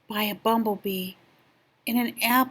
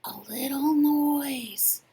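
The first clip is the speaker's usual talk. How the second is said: whisper; 4 semitones higher, slower than usual. Acted. Rabbit trying it out, whispering. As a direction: hush it out slowly. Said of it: "whispered the rabbit".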